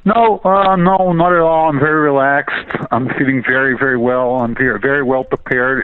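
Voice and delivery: ordinary talk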